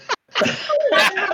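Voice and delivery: squealing high laugh; high burst of laughter. Real, not acted. Laughing at own joke.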